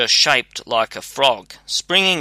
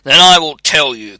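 louder, forceful declaration; raised voice, hard attack on the first words; crying out; 2 semitones higher, 8 dB louder than usual. A narrator's reading. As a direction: cry it out as a threatening spirit. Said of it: "cried the spirit".

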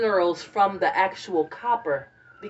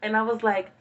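ordinary delivery

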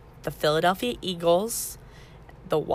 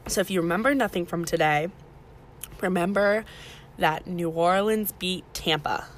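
ordinary talk, nothing marked

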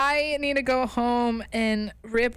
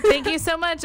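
higher, louder gushing thanks; perky email read-aloud; 6 semitones higher, 6 dB louder than usual. Acted. Reading her sent emails to co-host, perky read-aloud. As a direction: gush it like an overkeen email.

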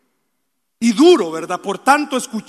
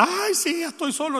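self-pitying whine; 7 semitones higher, 5 dB quieter than usual. Retold or imitated. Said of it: "no llore para".

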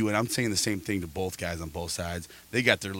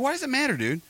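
much higher incredulous question; exasperated mock. Challenging the dude in the crowd, high incredulous mock. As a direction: throw it back at him in disbelief.

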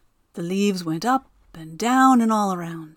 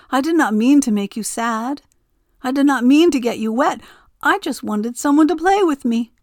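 pleading Wind; 5 semitones higher, 5 dB louder than usual. A narrator's reading.

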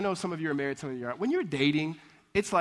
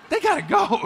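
high, loud comic insistence; punchy mock-serious ultimatum; emphatic verdict; 12 semitones higher, 9 dB louder than usual. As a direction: lay down the law, voice pitched up. Repeats his overalls verdict for laughs.

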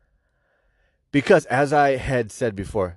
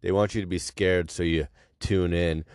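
normal talking voice

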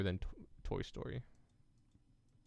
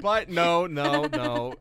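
loud protest; 8 semitones higher, 9 dB louder, much slower than usual. Real, not acted. Protests friend's outrage.

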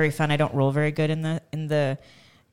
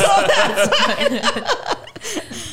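laughing; much slower than usual. Real, not acted.

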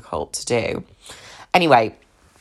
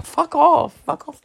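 indignant fuck off. Real, not acted. Venting about the ex, high indignant brush-off. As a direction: fling it away, indignant.

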